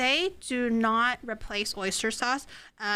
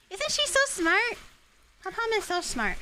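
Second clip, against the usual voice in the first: put-on doting baby talk; 9 semitones higher than usual. Streamer gushing to viewers about her dog, in high baby talk. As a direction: coo over the clever dog.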